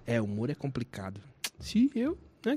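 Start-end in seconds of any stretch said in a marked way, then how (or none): 0.1-1.2 low, soft, serious imitation; lightly voiced serious friend; imitating earnest friend. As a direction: say it flatly serious, softly. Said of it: "ele falou".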